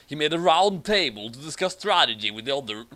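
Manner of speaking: normal talking voice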